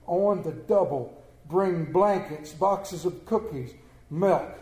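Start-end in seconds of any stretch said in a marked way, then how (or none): none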